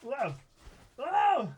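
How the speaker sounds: high, loud burst of delight; excited whoa. Real, not acted. Opening a fan box, thrilled at finding a letter, high excited exclamation. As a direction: squeal it out as the letter appears.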